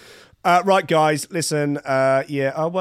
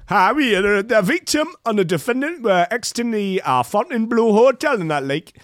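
raised, lightly done Miami accent; 7 semitones higher than usual. Shown as a demonstration. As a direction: read the report in a Miami accent. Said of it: "I'll do the Miami accent".

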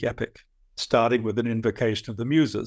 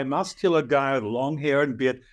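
ordinary talk

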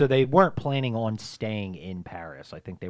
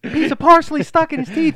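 high, loud, laughing; laughing mock yell; 9 dB louder than usual. Friend yelling silly details while laughing. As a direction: laugh through it.

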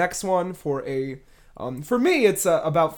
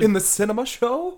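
surprised; 7 semitones higher, 5 dB louder than usual. Real, not acted. Surprised by the movie news.

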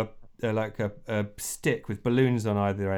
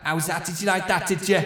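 rock frontman; 7 semitones higher than usual. Acted. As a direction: work the crowd from the mic. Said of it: "He goes".

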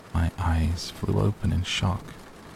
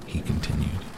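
plain reading voice

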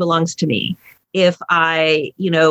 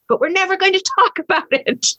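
very high punchline breaking into laughter; laughing; 12 semitones higher, faster than usual. Retold or imitated. Speaker mocking unspoken obligations in relationships, laughing. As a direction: laugh through it at a raised pitch.

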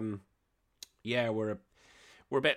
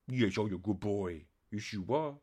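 cooing to the dog; 2 semitones lower, 4 dB quieter than usual. Real, not acted. Talking to his dog.

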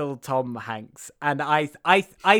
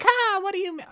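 imitating the strippers. Mimicking the strippers flirting with the congressman, high put-on voice. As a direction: pitch it way up like a ditzy stripper. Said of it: "And they're like".

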